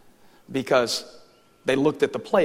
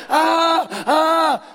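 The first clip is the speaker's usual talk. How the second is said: long, high, loud frustrated scream; hammed-up meltdown yell; mock scream; 8 dB louder than usual. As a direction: scream "ah" twice in mock despair. Acting out own meltdown.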